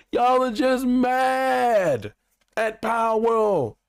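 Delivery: high, long-drawn accusing cry; exasperated taunt. Real, not acted. Fan taunting Nintendo as mad at Power World, high and drawn out.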